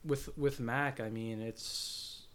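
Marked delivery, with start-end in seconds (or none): 1.0-2.2 slow, halting and lost for words; real shock, struggling to find words; speechless. Real, not acted. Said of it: "I know I sound speechless right now".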